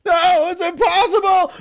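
hammed-up hero's disbelief; anguished young hero. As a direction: refuse the truth in despair. Reply to the villain line.

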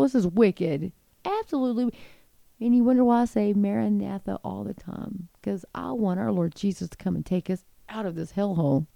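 ordinary talk, nothing marked

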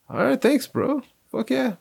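high, gleeful thanks; excited. Retold or imitated. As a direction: squeal it with delight. Retold happy thanks to driver.

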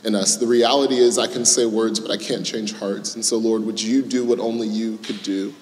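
soft, hushed prayer voice; opening prayer. Acted. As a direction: pray quietly and earnestly to God. Pastor leads an opening prayer.

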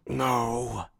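drawn-out, feeble protest; weak voice. Acted. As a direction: let it out as a wounded man. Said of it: "Barahir weakly says".

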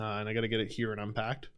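usual speaking voice

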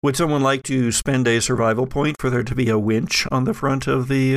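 ordinary talk

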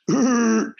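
high, dopey mock voice; goofy derp voice. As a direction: play yourself as a clueless doofus. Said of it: "derp, in my spandex".

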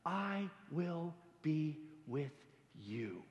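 slow, soft, reassuring promise; tender God voice. Acted. God promising Moses, soft and slow. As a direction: lean in and promise it gently, slowly. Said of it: "but God himself saying".

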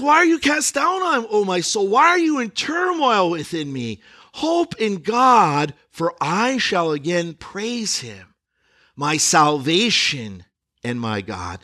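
high, slow, heartfelt scripture reading; psalm reading. Acted. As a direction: cry the psalm out slowly to the soul. Pastor reads psalm aloud.

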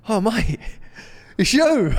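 high, excited mock fan greeting. A fan at the match greets the podcaster, high and excited.

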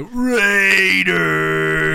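high, drawn-out bellow like a rowdy fan; imitated fan yell. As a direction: belt out a long stadium chant. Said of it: "some guy in the background go".

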